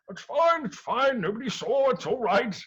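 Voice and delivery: high, hurried reassurance to himself; sneaky god. Acted. Poseidon secretly scooping up the items, high sneaky muttering. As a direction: pretend nobody noticed.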